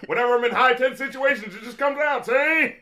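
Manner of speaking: put-on high, loud old gangster voice; exaggerated cartoon intonation. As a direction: play the nervous movie gangster, see. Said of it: "why are you talking like that".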